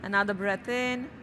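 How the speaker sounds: normal talking voice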